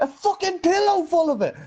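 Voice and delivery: retold amazement; mock astonishment. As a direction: act out being stunned. Incredulous retelling of the huge bag.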